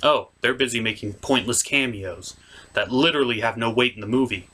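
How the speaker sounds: ordinary talk, nothing marked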